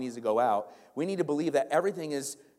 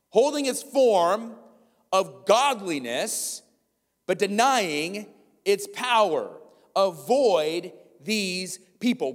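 very slow, higher, louder verse; slow emphatic preaching; 5 semitones higher, 4 dB louder, much slower than usual. Acted. Pastor reading the verse from the screen, slow forceful preaching. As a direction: lean on every word.

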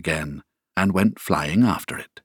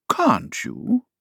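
pitying, sharply higher. A narrator's reading. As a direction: feel sorry for her. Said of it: "in a pitying tone".

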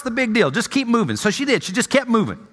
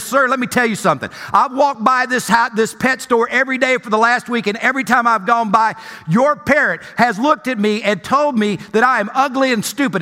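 ordinary talk, nothing marked

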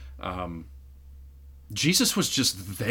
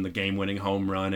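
normal talking voice

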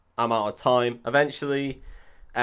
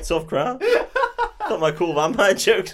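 stand-up comic; 9 semitones higher, 7 dB louder, slower than usual. Retold or imitated.

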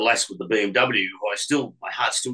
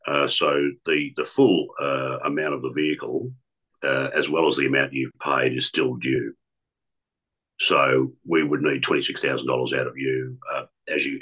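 credit company caller; 8 semitones lower than usual. Retold or imitated. Prank caller to young woman, stiff official collector voice. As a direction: lower put-on official debt collector voice. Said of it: "I phoned her from the credit company".

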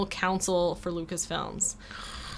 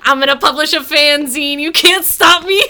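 high, loud hammed-up defiance; mock threat; 6 semitones higher, 11 dB louder than usual. Fanzine maker defying Lucas at gunpoint, mock threat. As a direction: shout it like a comic hostage-taker. Acting out gunman holding Lucas hostage.

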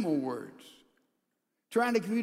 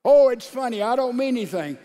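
loud, higher dismissive brush-off; mock excuse; 3 semitones higher, 9 dB louder than usual. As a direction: brush it off like a teasing gossip. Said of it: "Is that you?".

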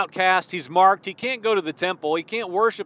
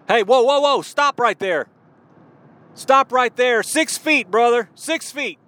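higher, louder warding-off cry; mock alarm; 5 semitones higher, 3 dB louder than usual. Mock Yeshua keeping a leper at a distance, acted alarm. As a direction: halt someone in exaggerated, pitched-up alarm.

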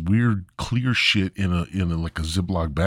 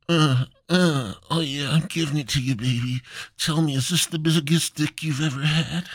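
higher, fast, hammed-up old man grunting; old man in bed; 7 semitones higher than usual. Old grandpa bragging in bed to his wife, mock-passionate. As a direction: ham up a creaky old lover bragging.

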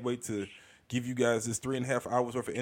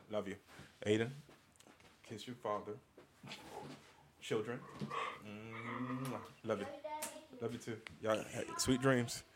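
tender; 9 dB quieter than usual. Real, not acted. Bedtime goodbyes to his kids.

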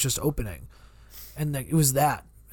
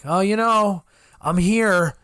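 a slightly cheesy, chummy greeting, a bit louder; cheesy friendly line; 4 semitones higher, 5 dB louder, slower than usual. Shown as a demonstration. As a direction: play it cheesy but not over the top. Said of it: "Not over the top".

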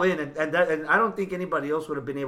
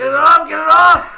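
frantic shout, high and loud; panicked yell; 12 semitones higher, 12 dB louder than usual. Retold or imitated. Quoting Thor's panicked yell.

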